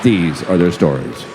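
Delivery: deep, solemn TV announcer; low narrator voice, slowing on the last phrase; Law and Order intro. Retold or imitated. Juror reciting a crime show's opening, deep announcer voice. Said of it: "I can sing you the song".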